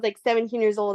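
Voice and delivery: ordinary talk, nothing marked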